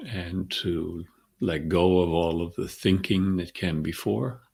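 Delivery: calm centering voice with long pauses between phrases; guided meditation. Acted. Teacher centering the group before class, calm guided meditation. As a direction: guide them gently into the breath, unhurried.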